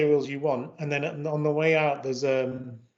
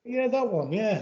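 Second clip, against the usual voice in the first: higher-pitched retold agreement; exasperated agreement; 7 semitones higher than usual. Driver finally understood by the customs officers, retold agreement. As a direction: imitate yourself confirming it, pitched up. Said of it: "And I was like".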